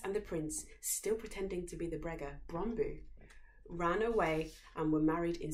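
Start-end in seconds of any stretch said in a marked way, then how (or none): none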